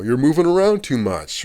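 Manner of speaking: mock complaint, pitched high and loud; high, loud imitation of a petty scolding; imitated nitpicking. Retelling bosses' petty reasons for firing, mock nitpicking. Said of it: "some stupid little nitpicky thing".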